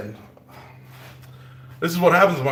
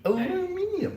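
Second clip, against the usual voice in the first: "aluminium" squeaked much higher, all syllables clear; playful squeak; 5 dB quieter than usual. Pretend. Ending her rant on naming metals, a high playful "aluminium". Playful correct-name flourish.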